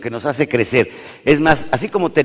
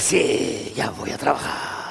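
soft, half-whispered groaning reply; tired father groaning at his child, soft and breathy; weary groan; 7 dB quieter, slower than usual. Retold or imitated. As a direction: sigh it out like a worn-out dad. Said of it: "la cultura del pujido".

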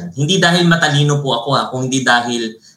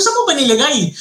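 mock innocent question; 12 semitones higher than usual. Mock reply in retold quarrel.

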